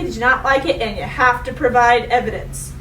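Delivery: usual speaking voice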